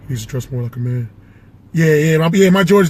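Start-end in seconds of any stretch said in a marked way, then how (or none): 0.0-1.1 low, quiet big-man mumble; Shaq. Acted. Co-host agreeing with Barkley about Westbrook, low soft Shaq voice. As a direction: drop it low and soft, short phrases with pauses. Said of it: "And then Shaq".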